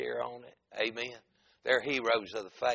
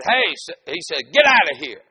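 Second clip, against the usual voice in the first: loud, higher angry shout; retold scream; 4 semitones higher, 12 dB louder than usual. Man at the gate to visiting preachers, screamed. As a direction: bellow to drive the visitors off. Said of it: "He screamed and said".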